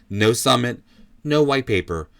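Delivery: normal talking voice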